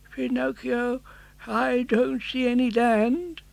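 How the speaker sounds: put-on slow, worried old father voice; a tired old man fearing the worst; old Geppetto. Geppetto anxiously telling Pinocchio of no land.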